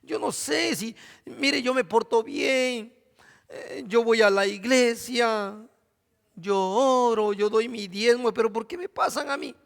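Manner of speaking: drawn-out mock lament over a small trial; mock whining. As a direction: whimper it like a spoiled believer. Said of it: "nosotros andamos llorando".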